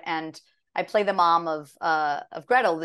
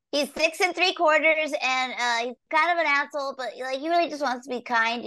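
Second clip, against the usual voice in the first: put-on higher little-boy voice with rasp; little raspy boy; 7 semitones higher than usual. Actress describing her dream animated boy, little raspy voice. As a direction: play a raspy six-year-old, pitched up. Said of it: "he's got a little raspy voice".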